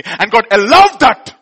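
high and loud, reliving the pain; impassioned preaching. Acted. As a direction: cry it out, caught up in the memory. Preaching at full force.

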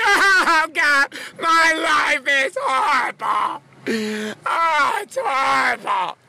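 high, loud, slow crying wail; mock moaning. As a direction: ham up a self-pitying moan. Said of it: "bitching and moaning".

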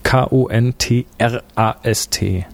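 K O N T R A S T spelled slowly, one letter at a time. Shown as a demonstration. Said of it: "Mit einem K, also Deutsch".

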